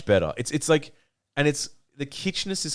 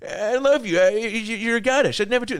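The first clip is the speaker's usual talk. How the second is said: high, louder begging; mock pleading; 11 semitones higher, 3 dB louder than usual. Boy begging the chainsaw girl, hammed-up high plea. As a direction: plead for your life, hammed up. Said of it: "She's like".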